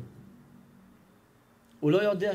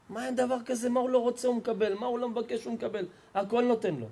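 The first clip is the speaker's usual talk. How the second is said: baffled father, voice pitched up in protest; higher, defensive: what more could he want; 5 semitones higher than usual. Retold or imitated. Father protesting to the rabbi, pitched up and baffled.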